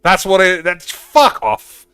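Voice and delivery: sudden high, loud outburst; explode, high and very loud; outraged shouting. Real, not acted. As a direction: blow up in outrage at what you see. Outburst at the card art.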